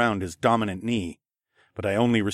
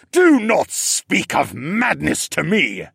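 loud, slow, higher roar; bellow; 8 semitones higher, 6 dB louder, slower than usual. A narrator's reading. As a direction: bellow it in wounded rage. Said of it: "he bellowed".

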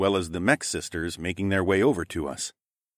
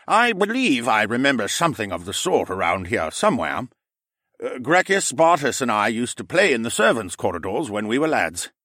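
put-on musing old uncle; 4 semitones higher, 3 dB louder than usual. Dinosaur uncle remembering.

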